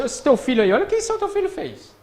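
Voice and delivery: high, loud scolding of the wife over the son; a fed-up father blaming the mother, voice climbing; exasperated husband. Retold or imitated. Husband scolding his wife over their son, loud and high. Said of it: "marido vira e fala assim".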